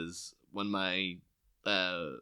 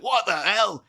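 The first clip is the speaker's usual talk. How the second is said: much higher and louder indignant yell; hammed-up complaint about cold soup; comic outraged shout; mock outrage; 12 semitones higher, 7 dB louder, slower than usual. Mock outraged boss over soup.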